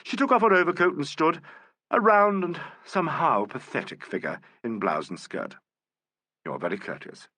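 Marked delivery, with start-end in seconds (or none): 6.4-7.3 quieter, lower and grave; a soft grave compliment. A narrator's reading. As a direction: say it gravely, measured respect. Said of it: "said Alan gravely".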